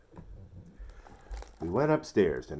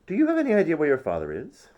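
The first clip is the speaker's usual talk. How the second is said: suspicious mother; 10 semitones higher, 8 dB louder, faster than usual. A narrator's reading. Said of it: "my mother asked".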